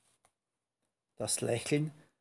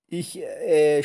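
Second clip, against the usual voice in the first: hesitant, louder stammer; 3 semitones higher, 7 dB louder than usual. A narrator's reading. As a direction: falter as if lost for words. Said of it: "stotterte die Hexe". (stotternd, um Worte verlegen)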